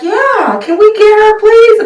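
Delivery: high-pitched imitation of a pleading little girl. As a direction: plead up high like a small child. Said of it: "Had the little girl like".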